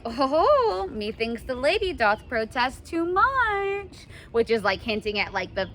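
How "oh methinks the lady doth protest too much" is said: slow, higher and sly; knowing tease. Pretend. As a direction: draw it out like you've caught her. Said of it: "And then they're like".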